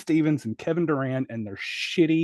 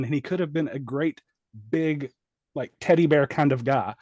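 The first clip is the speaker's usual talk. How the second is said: ordinary delivery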